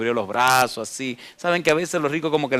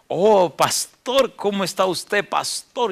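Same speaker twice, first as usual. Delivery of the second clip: higher, slow mock-sweet greeting; half-ironic greeting; 7 semitones higher, much slower than usual. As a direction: imitate the rich man's ironic smile. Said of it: "con una sonrisita medio irónica". (saludo irónico y meloso, más agudo)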